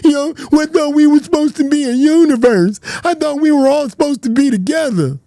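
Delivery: mock them as crying, pleading babies; mocking whiny crybaby. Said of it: "Y'all crying on Queens".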